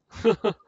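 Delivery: short, loud burst of laughter; scoffing laugh. Acted. Companion scoffs at a weak promise, laughing. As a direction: laugh loudly at the half-hearted promise.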